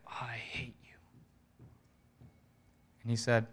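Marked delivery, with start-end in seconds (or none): 0.1-0.9 whispered loathing through the teeth; barely audible, seething whisper; hateful whisper. Retold or imitated. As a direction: whisper it with contained hatred. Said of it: "she said again".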